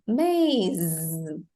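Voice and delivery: "Maze" finishing on a drawn-out buzz; low model word "Maze" with held Z; long final Z. Shown as a demonstration. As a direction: let "Maze" trail into zzz, no E sound. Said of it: "the Z sound, not the E sound".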